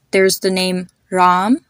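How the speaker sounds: ordinary delivery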